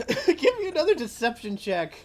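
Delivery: laughing through the words; can't hold back the laugh; cracking up while calling for a roll. Real, not acted. GM laughing at the scene.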